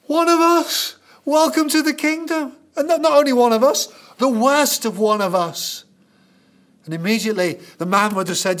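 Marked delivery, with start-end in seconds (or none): 0.1-5.9 much higher and louder, an astonished cry; amazed crowd. Retold or imitated. Crowd marvels at the healed man, amazed and loud. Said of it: "everyone gasps and says".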